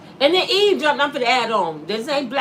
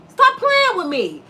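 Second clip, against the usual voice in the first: ordinary talk, nothing marked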